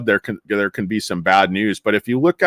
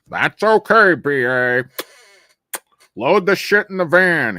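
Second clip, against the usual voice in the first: lightly acted teammate; 6 semitones higher, slower than usual. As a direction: call out to a teammate, hammed-up. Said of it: "He's like".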